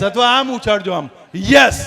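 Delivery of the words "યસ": punchy demonstration of a shouted yes; loud yes. Shown as a demonstration. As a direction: throw it out for the crowd to copy. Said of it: "જોરથી બોલવાનું છે, યસ".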